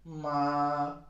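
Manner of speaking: "Ma" high and level, drawn out; first tone. Shown as a demonstration. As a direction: hold "Ma" on a steady high pitch. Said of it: "keeps on being high".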